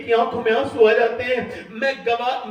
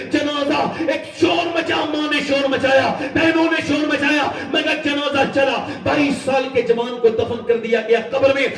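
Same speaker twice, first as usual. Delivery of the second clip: raised, urgent preaching with cries; preaching at full force; 4 semitones higher than usual. Acted. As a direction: drive the warning home to the crowd. Impassioned sermon, raised voice.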